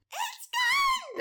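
very high squeaky shriek; falsetto screech. Shown as a demonstration. Host nails the screech for the Goosepunks. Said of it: "There you go".

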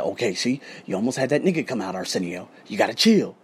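soft, very low private self-talk; self-soothing. Retold or imitated. Man in the elevator calming himself after a row, subdued. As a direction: settle yourself down under your voice.

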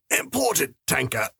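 high, strangled gasp. A narrator's reading. Seller held by the throat answering his attacker, gasping. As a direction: gasp it out as if throttled. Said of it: "I gasped".

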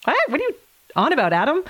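high-pitched, mock-baffled "what?"; acted disbelief at a colleague; incredulous. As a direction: retell being taken aback, pitch jumping up. Retold disbelief at colleague's prediction.

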